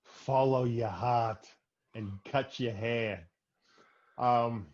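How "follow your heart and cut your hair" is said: put-on slow, drawn-out Miller's Crossing gangster accent; slow mock gangster accent giving fatherly advice; movie gangster accent. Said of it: "I'm just wondering what accent that was".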